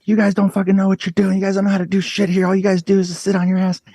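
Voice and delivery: screaming. Retold or imitated. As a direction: replay a teenage tantrum at the shelter staff. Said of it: "And I'm screaming at everybody".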